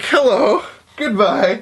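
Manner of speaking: panicked fake-casual hello; awkward high hello. Awkward greeting to a classmate.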